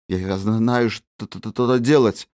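stuttering through chattering teeth; forced cheer, teeth clattering. Acted. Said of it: "лязгая зубами".

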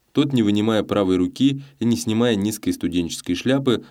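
usual speaking voice

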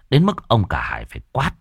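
plain reading voice

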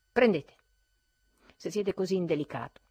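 loud, scornful throw-away; ruthless. A narrator's reading. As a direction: fling the words at him mercilessly. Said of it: "disse spietatamente".

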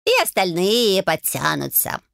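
slow purring cat voice. Acted. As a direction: drawl it like a smug old cat. Cat replies.

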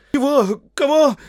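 very high, loud yelps; caught-out servant squealing; terrified squeak. Acted. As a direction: blurt it out in panic.

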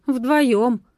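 «вдвоём» выше и громче, с иронией; протянуть «вдвоём» язвительно, передразнивая; насмешливое передразнивание. A narrator's reading. Передразнивает его слово. («вдвоём» насмешливо, с повышением)

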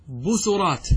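بصرات as "bu-su-raat", u after the s; middle vowel u. Shown as a demonstration. Teacher gives three options to students, بصرات as "bu-su-raat". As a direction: say بصرات with a damma on the second consonant. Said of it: "بالضم".

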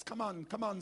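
normal talking voice

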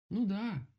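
high, loud call; shouted agreement. A narrator's reading. Bear shouts up to the woodpecker, high loud call. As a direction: shout it up the tree. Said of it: "крикнул ему Мишка".

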